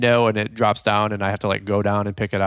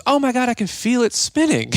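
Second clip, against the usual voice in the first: high-pitched imitation of an excited partner; mock excitement; 12 semitones higher than usual. Partner insisting she feels it spin, high mock excitement. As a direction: mimic her thrilled insistence, very high.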